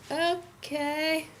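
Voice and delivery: high, soft, drawn-out okay; a small, high, dragged-out "okay"; hesitant okay. Retold or imitated. Camper answering office's throw-up warning, hesitant.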